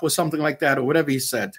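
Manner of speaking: ordinary delivery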